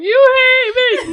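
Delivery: high, drawn-out tearful wail; hammed-up bawling accusation; mock crying. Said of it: "Why are you crying?".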